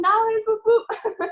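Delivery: high, loud cheer; celebratory whooping. Real, not acted. New diamond cheering her money goal, excited whooping. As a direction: let out a bright-pitched double whoop.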